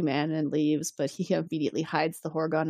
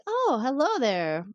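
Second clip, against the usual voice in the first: higher, drawn-out imitation of the woman; flirty greeting; 7 semitones higher, slower than usual. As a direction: coo a slow hello as her.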